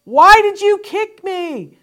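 a loud, high, slow cry across the room; very loud question. Shown as a demonstration. Mom demonstrating to parents the loud call a kicked child makes. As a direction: call it out so the whole class and the teacher hears. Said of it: "that you very loudly say".

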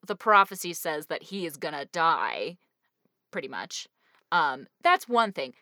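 ordinary talk, nothing marked

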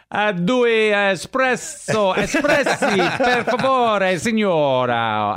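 high, slow, hammed-up Italian ordering; clumsy tourist Italian. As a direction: order drinks in over-eager tourist Italian, pitched high. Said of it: "my wife mocks me as I'm trying to order".

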